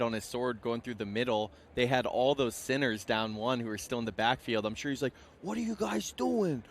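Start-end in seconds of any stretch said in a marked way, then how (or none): 5.4-6.6 high, hammed-up New York coach rant; a Staten Island yell at the team; New York accent. Coach chewing out his team in a New York accent. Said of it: "that was a solid New York accent".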